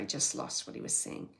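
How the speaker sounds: ordinary delivery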